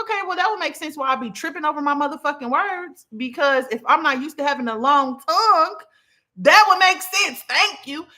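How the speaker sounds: high, building mock indignation; emphatic realization. Dreamer realizing why she trips over words, emphatically vindicated.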